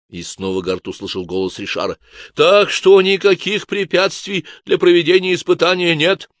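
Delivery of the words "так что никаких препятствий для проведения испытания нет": higher, louder unctuous voice; oily, smug suggestion; smug Richard. A narrator's reading. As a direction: Richard offers the lake, sly and syrupy. Richard again.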